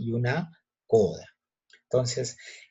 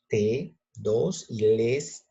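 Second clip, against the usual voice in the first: TE and LES slowly, each set apart; drawn-out TE, pause, count, then LES alone; counting syllables; 2 semitones lower, much slower than usual. Shown as a demonstration. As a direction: count off TE and LES as separate beats. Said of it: "¿Cuántas sílabas tiene?".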